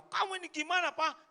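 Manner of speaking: high, loud nagging wife; scolding wife. Acted. Wife scolding her husband, high and loud. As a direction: snap at the husband like an annoyed wife.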